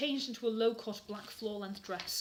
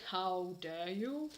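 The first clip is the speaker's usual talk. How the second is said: Snape; 3 semitones lower, much slower than usual. Acted. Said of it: "demanded Professor Snape".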